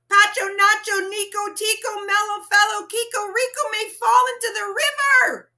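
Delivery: yelling at the top of his lungs. A narrator's reading. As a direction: bellow it so the papa can hear. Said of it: "yelled at the top of his lungs".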